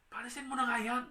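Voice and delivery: high, irritated demand; annoyed disciples. Retold or imitated. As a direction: act out fed-up disciples wanting someone sent away. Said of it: "mga disipulo ni Lord nung umpisa po ay nakakainis din". (inis na pagtataboy, mataas ang boses)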